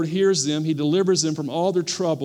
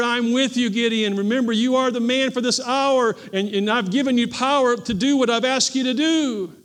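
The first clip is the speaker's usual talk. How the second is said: the Lord's voice; 6 semitones higher than usual. Acted. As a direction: speak as God encouraging Gideon. Voicing God to Gideon.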